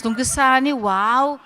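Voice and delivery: usual speaking voice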